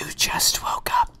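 high breathy whispered line. Shown as a demonstration. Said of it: "This is whispers".

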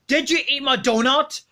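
ordinary delivery

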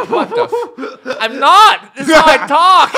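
high, very loud outburst; indignant protest. Retold or imitated. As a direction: shout it back in mock outrage. Protesting at being accused of accent.

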